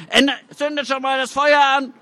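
high, jeering voice; second heckler jeering from the crowd; mocking call. A narrator's reading. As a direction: call out scornfully. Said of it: "ruft ein zweiter".

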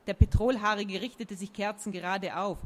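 ordinary delivery

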